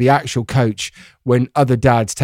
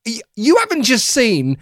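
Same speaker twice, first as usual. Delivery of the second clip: indignant; 8 semitones higher than usual. Retold or imitated. Defending himself to wife.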